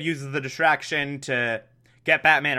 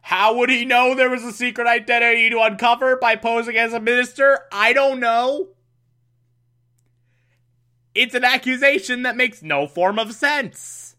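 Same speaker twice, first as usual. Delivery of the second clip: high-pitched baffled rant; incredulous; 6 semitones higher than usual. Real, not acted. Recapper questions Batgirl's illogical accusation, high incredulous complaint. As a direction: protest the nonsense in disbelief.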